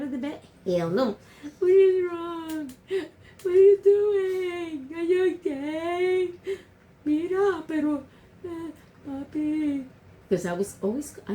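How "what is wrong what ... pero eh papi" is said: high, slow, tearful pleading; a scared kid near tears calling his brother; crying child. Retold or imitated. Child begging his brother, slow and tearful. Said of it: "beginning to start to cry".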